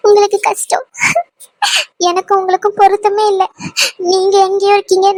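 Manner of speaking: crying through the words; tearful. Acted. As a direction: voice choked with tears. Anu breaks down confessing.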